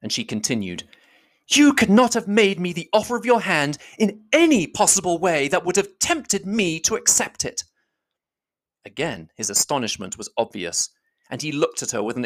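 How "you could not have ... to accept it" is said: put-on higher young woman's voice, accusing; a higher heroine's voice pressing her charge; Elizabeth, indignant. Elizabeth confronting Darcy, higher and accusing.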